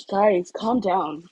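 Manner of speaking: very slow, calming; slow it right down to soothe; slowly. Acted. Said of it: "Kirishima said slowly".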